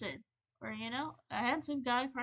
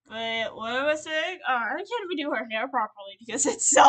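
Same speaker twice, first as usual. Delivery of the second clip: laughing through tears; 3 semitones higher, 11 dB louder than usual. Real, not acted. Telling viewers about Momoe, laughing loudly through tears. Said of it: "crying my ass off".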